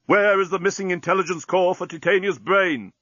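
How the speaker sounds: insistent captain. A narrator's reading. Captain demanding the parrot answer, insistent.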